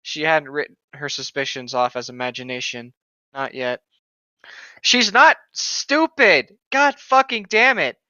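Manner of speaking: ordinary talk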